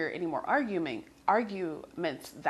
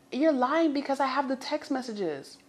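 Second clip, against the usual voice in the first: mock accusing shout; 7 semitones higher, 3 dB louder than usual. Girlfriend confronts him.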